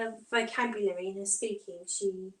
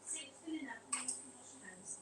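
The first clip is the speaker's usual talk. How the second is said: whisper; 2 semitones higher, 19 dB quieter than usual. Real, not acted. Helping toddler wash hands.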